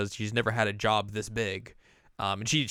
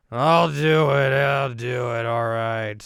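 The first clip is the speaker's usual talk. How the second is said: put-on loud, slow drunken agreement; slurred, drawn-out drunk impression; 2 semitones higher, 9 dB louder, much slower than usual. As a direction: bellow it sloppily as a mock drunk. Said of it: "He is wasted".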